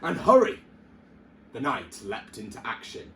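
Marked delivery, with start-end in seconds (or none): 0.0-0.6 loud, high urgent shout. A narrator's reading. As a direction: yell it like an impatient king. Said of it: "he barked".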